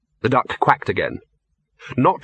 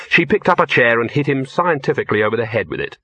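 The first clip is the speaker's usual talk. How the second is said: ordinary delivery, nothing marked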